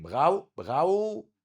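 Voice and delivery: بغاو said slowly twice with r as gh; R as ghayn. Shown as a demonstration. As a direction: draw out بغاو with a throaty German r. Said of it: "قلبها غين". (نطق بغاو ببطء والراء غين)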